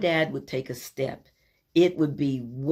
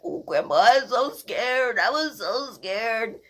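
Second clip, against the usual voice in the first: put-on high, slow, small frightened child voice; rescued granddaughter telling grandpa how scared she was, high and slow; scared little girl; 8 semitones higher than usual. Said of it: "she's like".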